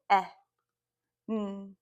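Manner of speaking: short "eh", pause, then a hummed "n"; isolated phonemes. Shown as a demonstration. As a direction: sound out "eh" and "n" separately. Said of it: "Second syllable".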